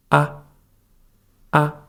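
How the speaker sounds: ah short and light; brief, unstressed ah; soft neutral tone. Shown as a demonstration. Teacher recapping the tones, ah short and soft. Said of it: "the last one is very soft".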